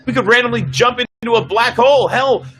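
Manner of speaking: captain firm, officer alarmed; alarmed officer pushing back on a risky order; bridge officers arguing. Acted. Officer protests the jump to his captain, alarmed.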